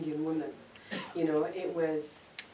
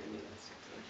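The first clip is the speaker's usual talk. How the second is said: breathy whispered aside, almost no voice; 15 dB quieter, faster than usual. Real, not acted. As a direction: let it slip out as a whisper to yourself. Aside to audience, confiding.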